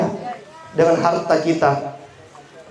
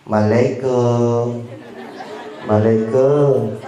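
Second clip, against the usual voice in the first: very slow, lingering greeting; imitating a neighbour's leisurely doorstep salam; drawn-out salam; 3 semitones lower, much slower than usual. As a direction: stretch out each syllable of the greeting unhurriedly. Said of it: "Kalau salamnya begini".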